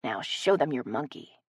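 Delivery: soft, low whisper. A narrator's reading. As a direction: lean in and whisper it.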